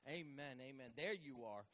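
usual speaking voice

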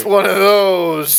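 slow, high, airy brush-off; mock dismissive shrug voice. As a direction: shrug it off lazily. Acting out his reply.

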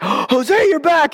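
high-pitched acted surprise; excited greeting. Warren finds Jose back at church, excited high greeting.

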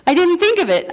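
high, loud, real amusement; amused outburst. Real, not acted. Speaker confessing her oversight to the audience, laughing. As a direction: blurt it out between laughing fits.

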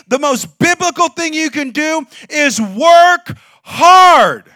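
slow, loud, rising preacher shout; full-force preaching. Acted. Pastor urging congregation to work hard, full-force preaching shout. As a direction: drive it home to the congregation.

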